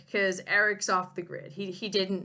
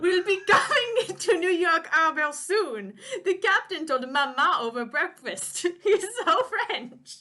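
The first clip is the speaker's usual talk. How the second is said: put-on higher, louder boyish chatter through laughs; an eager kid sharing news; young Pierre telling his tutor about the captain; excited boy; 8 semitones higher, 5 dB louder than usual.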